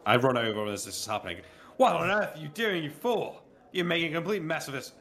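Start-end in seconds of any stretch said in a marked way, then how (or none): 1.8-4.9 angry scolding shout. Acted. Master yelling at the groom for the spilled saddle, angry shout. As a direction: bellow at the clumsy servant.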